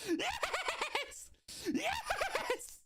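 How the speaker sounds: very high drawn-out victory shriek; ecstatic scream. Real, not acted. Celebrating a goal.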